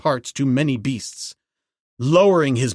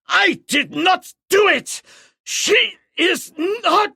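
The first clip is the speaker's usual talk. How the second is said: high, loud dying shout; high, loud, desperate dying cry; gasping cry; 3 dB louder, slower than usual. A narrator's reading. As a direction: cry out high in a last gasp. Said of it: "gasping cry".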